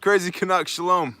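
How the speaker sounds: loud, high, thrilled shout of welcome; excited greeting. Real, not acted. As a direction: cheer the name like the party just started. Greets a favourite viewer.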